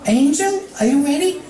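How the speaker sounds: Fluttershy checking the bunny is ready; high pony voice. Acted.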